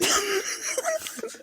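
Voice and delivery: high crying laugh; teary laugh. Real, not acted. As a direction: laugh till near tears. Laughing near tears.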